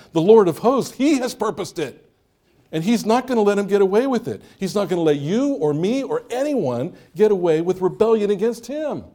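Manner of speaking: fiery proclamation. Acted. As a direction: thunder it out like a revival preacher. Preacher proclaiming God's verdict.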